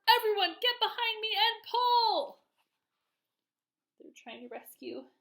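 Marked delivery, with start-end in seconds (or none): 0.1-2.4 rally everyone with a big shout. A narrator's reading. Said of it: "shouted the old green grasshopper".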